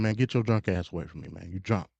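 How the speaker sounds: ordinary talk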